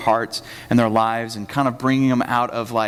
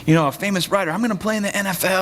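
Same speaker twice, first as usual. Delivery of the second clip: imitating an eager child; 6 semitones higher than usual. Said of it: "Kinda like your grandma".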